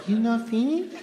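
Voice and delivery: high imitated Japanese accent, rising at the end; a gentler take on the waitress's Japanese accent; subtle Japanese accent. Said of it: "No, it was subtle".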